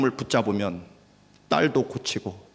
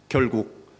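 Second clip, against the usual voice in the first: ordinary delivery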